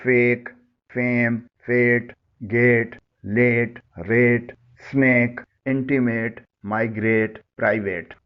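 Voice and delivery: slow, clear model words with the ay sound, as in fake, fame, gate; teacher modelling the ay vowel word by word; careful pronunciation demo. Shown as a demonstration. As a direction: say فیک, فیم, گیٹ, ریٹ with a long ay vowel and a silent final e. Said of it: "ان الفاظ کی sound سنیں".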